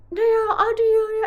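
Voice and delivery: high, loud imitation of a fussing helper; cartoonish high-pitched take on a kindly neighbour; mock insistent neighbour. Acting out the helpful neighbour.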